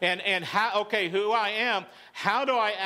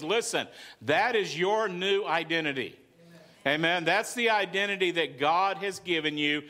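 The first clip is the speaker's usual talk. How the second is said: ordinary delivery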